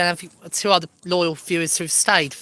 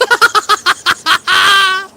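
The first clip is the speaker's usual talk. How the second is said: loud high laugh; 13 semitones higher, 9 dB louder than usual. Real, not acted. Laughing at joke.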